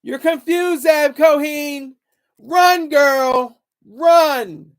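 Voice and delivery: high, loud taunt at the hater, stretching the name; taunting shout. Real, not acted.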